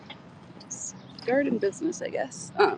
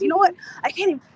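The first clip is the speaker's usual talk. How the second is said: imitating an indignant woman, high-pitched and louder; retold exasperation; 9 semitones higher, 4 dB louder, faster than usual. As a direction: act out a fed-up telling-off, voice pushed up high. Retelling a woman's angry outburst.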